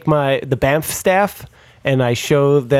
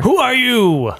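high, drawn-out mock yell; yelled challenge; 9 semitones higher, 3 dB louder, slower than usual. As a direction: call up to them in a high demanding voice. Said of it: "I point up and I yell at".

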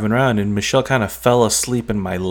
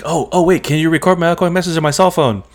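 eager plea; 8 semitones higher, 4 dB louder than usual. Retold or imitated. Rushing to ask Obama a favour.

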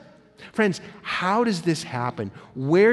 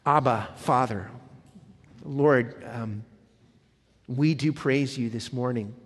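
prayer; 4 semitones lower than usual. Acted. Pastor leads prayer.

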